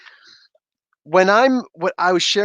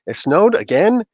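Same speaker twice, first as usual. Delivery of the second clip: annoyed surprise; 6 semitones higher, slower than usual. Retold or imitated.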